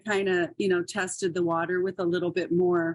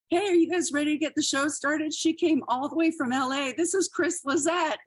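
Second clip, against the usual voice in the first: a mock emcee shouting an intro; 7 semitones higher than usual. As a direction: pump up the room like a club MC. Host hyping up the crowd.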